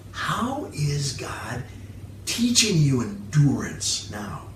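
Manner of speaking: quiet personal challenge to the congregation; soft reflective questions. Real, not acted. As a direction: lean in and ask gently. Preacher asks reflective closing questions.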